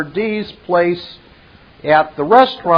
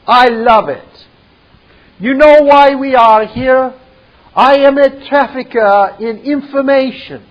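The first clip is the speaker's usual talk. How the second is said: higher, louder boastful connoisseur; Merovian voice; 4 semitones higher, 3 dB louder than usual. Acted. Merovian boasts to his guests about French wine, raised smug voice. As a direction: savour every word like fine wine, smug. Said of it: "Merovian goes on".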